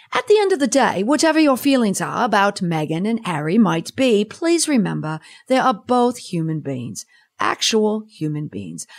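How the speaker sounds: hammed-up English accent; amateur put-on English accent; bad English accent. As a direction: read it in a badly faked English accent. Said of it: "bad English accent aside".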